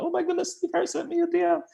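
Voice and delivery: very high, fast excited squeal; freaking out. Retold or imitated. Follower squeals over a DM from the coach, high and fast. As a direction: shriek it like a fan who just got a DM. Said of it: "they're excited and, like, freaking out".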